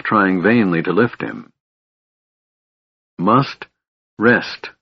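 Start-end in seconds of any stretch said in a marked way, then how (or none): 3.2-4.7 halting, effortful words; a touch of aching weariness; throat aching. A narrator's reading. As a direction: gasp out each word separately, mildly. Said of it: "her throat aching".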